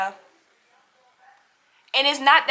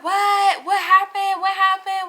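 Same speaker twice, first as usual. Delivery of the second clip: surprised; 7 semitones higher, 5 dB louder, slower than usual. Retold or imitated. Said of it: "they was like".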